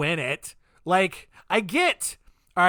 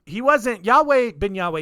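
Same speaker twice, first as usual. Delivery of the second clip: normal talking voice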